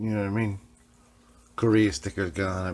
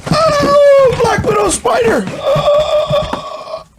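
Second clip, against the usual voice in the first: mock terror; 11 dB louder, much slower than usual.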